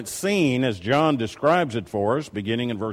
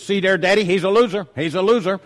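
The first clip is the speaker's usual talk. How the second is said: a little girl's retold call, higher and louder; child's loud call-out; 6 semitones higher, 4 dB louder, faster than usual. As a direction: pipe up loud across the restaurant like a small child. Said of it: "And they say out loud".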